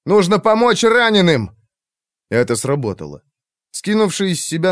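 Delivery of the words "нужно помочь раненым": urgent shout. A narrator's reading. Will shouting at the mage.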